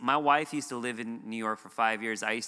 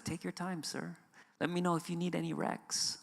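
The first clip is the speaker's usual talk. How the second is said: higher and softer, easygoing counter-worker voice; friendly bagel worker; 4 semitones higher, 7 dB quieter than usual. Retold or imitated. Bagel shop worker kindly telling the customer to take his time, soft and easy. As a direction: lightly play the relaxed server offering help. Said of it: "she was like".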